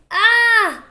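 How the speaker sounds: high, loud squeak of fright; the mouse squeaks in panic; frightened squeak. Acted. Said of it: "the mouse squeaked".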